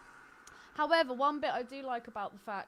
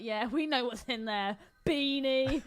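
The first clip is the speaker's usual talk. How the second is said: mocking schoolkid taunt; 2 semitones higher, 7 dB louder than usual. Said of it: "would be like".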